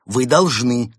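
ordinary delivery, nothing marked

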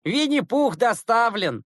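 high, drawn-out mock announcement; slow, high-pitched schoolboy jeer; jeering chime-in. A narrator's reading. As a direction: join the teasing with glee. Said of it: "подхватили Загоркин и Симак".

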